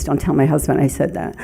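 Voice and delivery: normal talking voice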